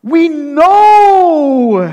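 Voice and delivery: loud, high ringing proclamation; emphatic exclamation. Acted. Pastor declaring to the congregation, loud and high. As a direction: proclaim it out to the whole room. Preacher bursting into English emphasis.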